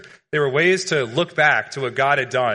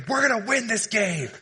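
high, strained mock yell, held back in volume; mad locker-room rally cry; 8 semitones higher than usual. Player to losing team in a sports movie, mock-mad rallying cry. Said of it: "he's so mad".

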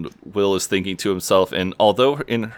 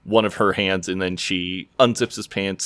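usual speaking voice

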